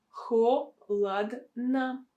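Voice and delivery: slow "Холодно", HO - lad - na; syllable-by-syllable demo. Shown as a demonstration. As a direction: separate the syllables of "Холодно", last two with A. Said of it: "pronounced as a sound A".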